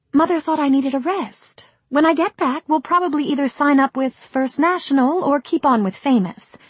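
put-on high, bright young actress. Rosemary speaks.

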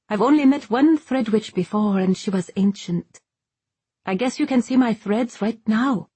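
lively, high dialogue voice; Ryber, friendly sailor. Acted. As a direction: pitch it up as an eager sailor girl. Ryber talks to Iseult.

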